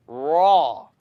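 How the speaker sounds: raw said loud with a full open a; teacher demo. Shown as a demonstration. Teacher calls out raw for students to repeat. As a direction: model raw loudly for the class. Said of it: "It's the way you eat your steak".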